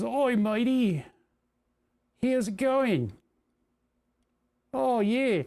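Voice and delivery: high, drawn-out, hammed-up Australian accent; embarrassing Australian accent. Teasing imitation of a cousin overseas, in a hammed-up Australian accent. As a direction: do a deliberately bad, high-pitched Aussie accent. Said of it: "I'm trying to reproduce an embarrassing Australian".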